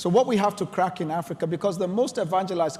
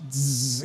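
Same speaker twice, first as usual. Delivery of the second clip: imitated fly buzzing, lower, on a steady zzz; 4 semitones lower than usual. Preacher imitates a trapped fly with a low buzz. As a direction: buzz like a fly stuck at a window. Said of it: "it's buzzing".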